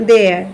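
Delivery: দেয়ার with an "e" glide, low and falling; there with E. Shown as a demonstration. Teacher contrasts there with here, দেয়ার on e glide. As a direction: say দেয়ার on an e vowel, pitch dropped. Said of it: "আর এখানে বলছি".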